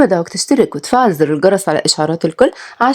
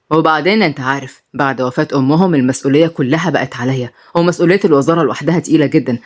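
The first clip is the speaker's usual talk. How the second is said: lower, easy men's conversation; grown men talking; 6 semitones lower than usual. Acted. The minister and his friend chat over papers, lower and relaxed. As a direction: banter like old friends in an office.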